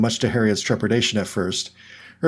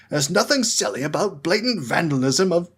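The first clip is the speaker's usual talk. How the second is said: outraged; 7 semitones higher than usual. A narrator's reading.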